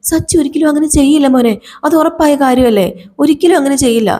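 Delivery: a mother swearing her son is innocent; pleading grandmother. Acted.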